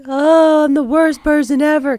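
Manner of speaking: high, loud whiny self-pity; hammed-up poor-me voice; mock self-pity. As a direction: wail it like a martyr. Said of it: "I'm not gonna sit there and be like".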